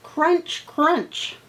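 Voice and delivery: high, loud crunch, crunch acted like a bite; crunching sound word. Shown as a demonstration. Teacher shows kids how a carrot sounds, high and loud. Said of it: "watch what I do with a little bit of onomatopoeia".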